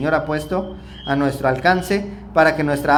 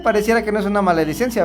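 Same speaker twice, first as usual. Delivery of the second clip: usual speaking voice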